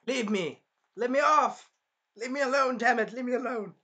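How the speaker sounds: mock anguished outburst; distressed rejection. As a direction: yell to be left alone. Voicing the hero pushing her away.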